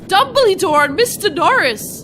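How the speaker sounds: high, loud dramatic announcement; dramatic reveal. Acted.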